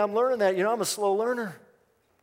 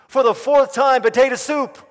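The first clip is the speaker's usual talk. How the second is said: loud, higher-pitched mock retort; exasperated wife; 5 semitones higher, 8 dB louder than usual. Wife turning on her husband, loudly exasperated. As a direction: hit the punchline as a fed-up reply. Fed-up wife's joke punchline.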